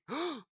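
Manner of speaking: high, quick intake of breath; acted gasp of alarm; sharp gasp. A narrator's reading. Gasp before exclaiming.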